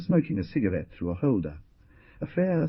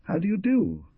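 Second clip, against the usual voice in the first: higher, prim and demure greeting; demure girl; 12 semitones higher than usual. A narrator's reading. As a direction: play it coy and proper. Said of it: "advanced demurely".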